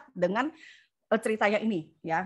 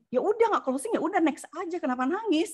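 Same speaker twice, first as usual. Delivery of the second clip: pitched well higher, coaxing, brushing it off; leader's high consoling voice; 8 semitones higher than usual. Retold or imitated. Leader consoling a crying agent on the phone, high and coaxing. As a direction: cheer her up, it's fine, move on. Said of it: "dia bilang gitu".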